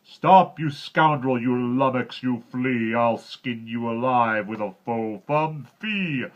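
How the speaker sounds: loud, high furious giant roar; slow, booming giant rage; giant bellowing. A narrator's reading. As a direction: roar threats at the thief.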